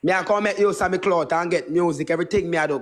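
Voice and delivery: normal talking voice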